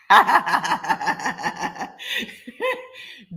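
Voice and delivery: long real burst of laughter; cracking up at a comment. Real, not acted. Laughing hard at a viewer's comment in the live chat.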